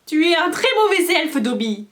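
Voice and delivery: high, loud whining reproach; moaning elf. A narrator's reading. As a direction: moan it through tears. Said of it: "gémit Winky".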